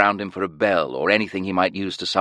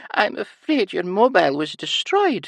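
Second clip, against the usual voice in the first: high sing-song with a sigh; regretful high nurse; Scottish nurse voice; 14 semitones higher than usual. A narrator's reading. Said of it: "The nurse sighed".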